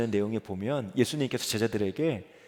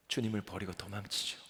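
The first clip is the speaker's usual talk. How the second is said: ordinary delivery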